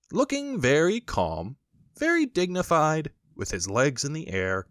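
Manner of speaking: plain reading voice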